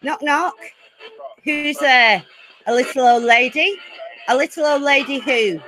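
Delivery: ordinary delivery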